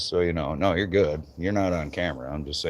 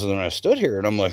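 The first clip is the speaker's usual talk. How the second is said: ordinary delivery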